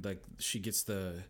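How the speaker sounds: normal talking voice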